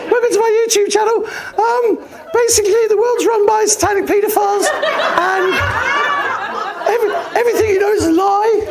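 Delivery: squeaky high voice, laughing through it; a nervous first vlog in a very high voice; high-pitched nervous voice. Retold or imitated. Filming his first YouTube video, nervous, very high-pitched. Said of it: "my voice was all high-pitched".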